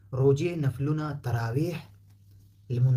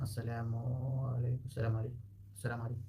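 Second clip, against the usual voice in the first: light, much softer السلام عليكم; simple greeting; 9 dB quieter, faster than usual. Shown as a demonstration. Teacher repeating the everyday greeting. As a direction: mutter the casual greeting. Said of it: "شيء بسيط يعني".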